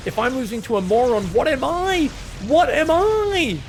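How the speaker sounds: high, loud, mock-desperate cry; panicked inner voice. The ego panicking mid-game, a high loud mock wail. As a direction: wail it like your ego just cracked. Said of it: "that voice in the back of your head that's going".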